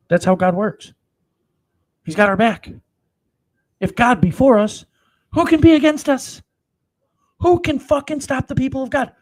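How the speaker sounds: thundering preacher climbing to a shout; rising preaching shout. Real, not acted. Preaching climax, building.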